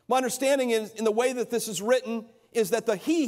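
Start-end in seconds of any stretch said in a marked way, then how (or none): 3.0-3.3 "he" lifted high and hit hard; lone "he" pitched well above the rest; emphatic he. Shown as a demonstration. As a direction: make "he" the peak of the line. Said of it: "here is emphatic".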